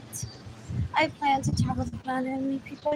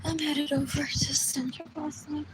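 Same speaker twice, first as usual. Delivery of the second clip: soft unvoiced whisper; 5 dB quieter than usual. Real, not acted. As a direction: whisper it quietly.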